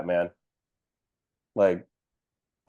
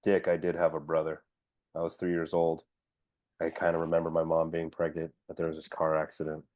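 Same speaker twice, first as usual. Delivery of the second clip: low, soft mock-solemn voice; brooding hero confession; 5 semitones lower, 8 dB quieter than usual. Hero admits to Dick he had a brother, low and hushed. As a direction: confide it quietly, low and halting. Acts Batman after the big battle.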